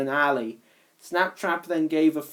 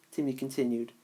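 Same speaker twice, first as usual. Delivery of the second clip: ordinary delivery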